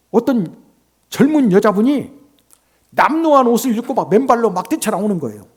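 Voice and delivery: ordinary talk, nothing marked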